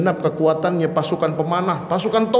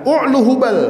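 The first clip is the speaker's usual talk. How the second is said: proud boast; 8 semitones higher, 4 dB louder than usual. Retold or imitated. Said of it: "dengan bangganya sambil mengatakan".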